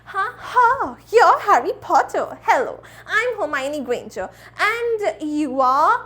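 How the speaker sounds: hammed-up British schoolgirl accent, drawn out; theatrical British accent, swooping pitch; Hermione Granger. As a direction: introduce yourself in put-on British English. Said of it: "Harry Potter universe की Hermione".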